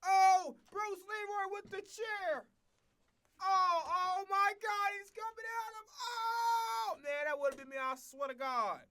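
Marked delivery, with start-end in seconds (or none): 0.1-7.0 high, drawn-out cries of mock commentary; excited high-pitched play-by-play yell; wrestling commentator. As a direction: call the chair hit like a shocked ringside announcer. Mimics wrestling commentary of chair hit.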